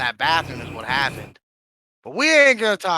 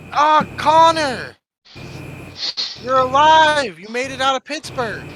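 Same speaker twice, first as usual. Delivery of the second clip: high, loud joyful reunion; excited; 9 semitones higher, 6 dB louder, slower than usual. Acted. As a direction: greet a lost friend thrilled. Happy reunion with lost character.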